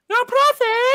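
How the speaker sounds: high, loud frightened squeak; panicked high-pitched plea; scared voice. Pretend. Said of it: "Are you malfunctioning again?".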